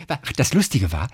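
ordinary talk

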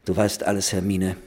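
plain reading voice

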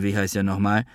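ordinary delivery

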